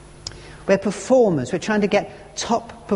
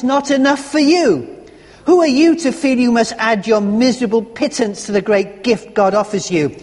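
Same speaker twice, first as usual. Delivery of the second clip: growing anger; 5 semitones higher, 4 dB louder than usual. Retold or imitated. Doctor angrily confronts his guilt-ridden patient, louder and indignant. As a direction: build an indignant rebuke, pressing each question. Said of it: "I found my anger increasing".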